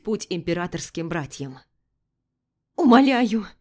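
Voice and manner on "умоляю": loud, high begging cry; desperate exclamation. Acted. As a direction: cry out imploring. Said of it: "воскликнула я".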